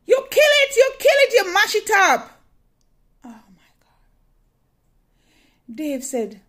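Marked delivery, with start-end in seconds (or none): 0.1-2.4 much higher and louder, thrilled yelling; excited shouting. Real, not acted. Ecstatic call to husband. 3.2-4.0 whisper. Real, not acted. Aside after the excitement.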